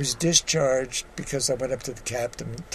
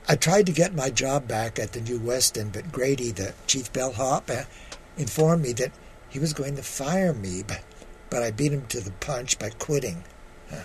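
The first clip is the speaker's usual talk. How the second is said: measured storytelling read with voiced quotes; memoir reading; 3 dB quieter than usual. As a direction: read your story to the audience. Reads his memoir piece aloud.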